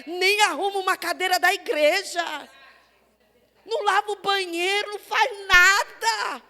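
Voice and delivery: loud, driving sermon cadence; fiery preaching. Acted. Pastor thundering at the church about people who do nothing, loud. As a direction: hammer the congregation with each line.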